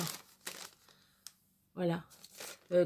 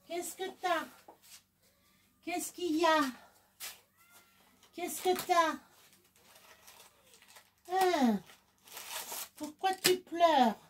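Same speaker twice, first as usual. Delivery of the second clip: pet talk; 7 semitones higher than usual. Real, not acted. Soothing her whining pet.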